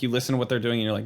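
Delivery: ordinary delivery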